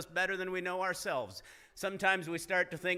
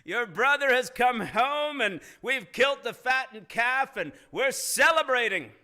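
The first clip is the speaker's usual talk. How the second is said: higher and louder, bursting with the news; excited servant; 7 semitones higher, 8 dB louder than usual. Acted. Servant telling the older son, thrilled and loud. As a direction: announce it like the party is already on. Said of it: "And he said".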